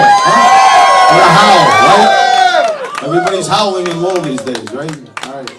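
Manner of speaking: shouting high and loud at the party; boozy, booming party cheerleader; rowdy crowd rousing. Acted. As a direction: whip the drunk crowd into a howl.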